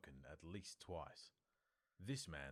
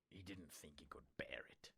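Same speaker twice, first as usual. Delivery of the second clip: softer, breathy whisper; a whispered, dread-filled close; 6 dB quieter than usual. A narrator's reading. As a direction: whisper the fear. Narration of dread.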